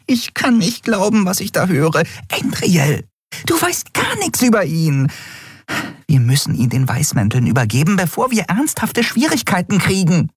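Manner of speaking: high, tiny fairy voice, upset; a little winged friend scolding in alarm; agitated fairy. A narrator's reading. (aufgeregte kleine Fee, hoch)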